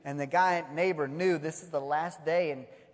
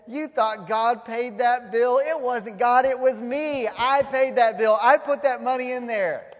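loud, raised, emphatic boast; gloating reveal; 4 semitones higher, 6 dB louder than usual. Acted. Neighbor reveals he paid the bill, gloating loudly. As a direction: hammer home the triumphant gotcha.

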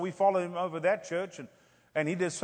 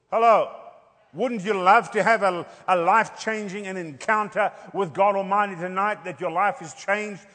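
preaching at full force; 2 semitones higher, 4 dB louder than usual. Acted. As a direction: preach it out, building. Preaching rising.

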